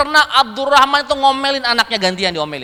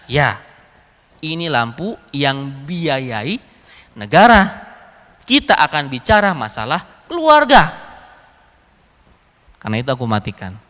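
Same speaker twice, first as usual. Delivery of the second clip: Umar; 8 semitones lower than usual. Retold or imitated. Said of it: "Kata Umar".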